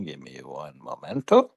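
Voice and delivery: normal talking voice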